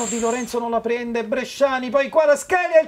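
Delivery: building commentary. Real, not acted. Live commentary of an attack.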